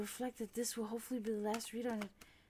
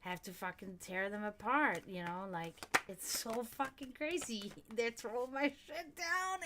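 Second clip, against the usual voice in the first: ordinary talk, nothing marked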